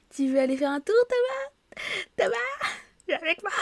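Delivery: very high, playful squeal calling a friend; childish coaxing call. Pretend. Player playfully begging Thomas to come along, high childish call. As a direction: coax him along like a pleading child.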